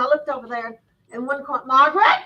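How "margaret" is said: loud searching call, rising at the end. Retold or imitated. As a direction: call out to find her. Searching the room for her.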